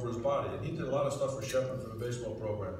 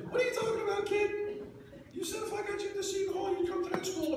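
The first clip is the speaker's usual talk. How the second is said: very high excited squeal; high-pitched mock shout of an excited coach; voice up octaves. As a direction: shoot up into a squeaky excited yell. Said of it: "his voice went up a couple of octaves".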